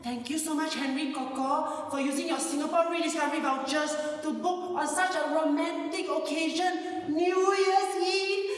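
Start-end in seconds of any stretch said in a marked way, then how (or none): none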